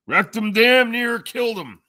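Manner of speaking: high, loud, put-on exclamation; high-pitched mock holler; shouted punchline. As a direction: belt out the old joke high and sustained. Calls out an old punchline.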